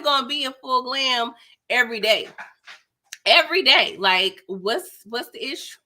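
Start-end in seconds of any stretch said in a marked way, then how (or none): 3.3-4.0 louder, higher insistence; emphatic. Real, not acted. Housewife defending full glam every day, loud emphatic. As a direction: hit it hard, no apology.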